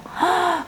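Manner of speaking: a quick high gasp of shock; sharp gasp. Retold or imitated. Lecturer gasps as the child spills the juice.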